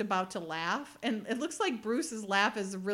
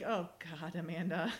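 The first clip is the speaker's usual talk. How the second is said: low, softer retold groan of dismay; mock exasperation; 4 semitones lower, 5 dB quieter than usual. Agent reacting to his partner's badge, low mock exasperation. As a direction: imitate his weary dismay, pitched down.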